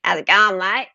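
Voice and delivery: mock broad Aussie Bogan greeting; lightly imitated Bogan accent. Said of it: "Like that, that's Bogan".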